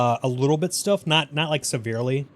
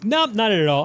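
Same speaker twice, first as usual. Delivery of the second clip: breezy dismissal; 8 semitones higher, 6 dB louder than usual. Real, not acted. Answering NPC cheekily.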